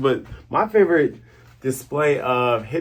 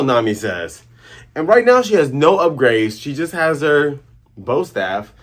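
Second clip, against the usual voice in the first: ordinary talk, nothing marked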